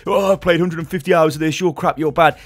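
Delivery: hammed-up toxic player rant. Imitating a toxic player insulting a teammate, mock ranting. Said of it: "toxic and you're".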